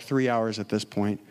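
normal talking voice

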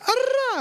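very high wordless whoop; high-pitched mock holler; imitated yell. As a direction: let out a weird high yell to test the echo. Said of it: "so I just yelled".

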